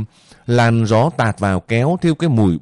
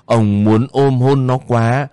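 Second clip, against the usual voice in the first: usual speaking voice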